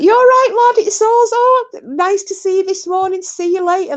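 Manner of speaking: very high, loud gushing greeting; gushing fan. Retold or imitated. Starstruck fan greeting a film star from a car, high loud gushing. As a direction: babble at him like a starstruck fan. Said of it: "And I'm like".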